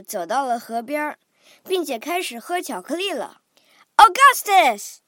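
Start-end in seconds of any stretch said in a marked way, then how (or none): 4.0-5.0 high, loud drawn-out call; father shouting his son's name; shouted. A narrator's reading. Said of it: "shouted Mister Gloop".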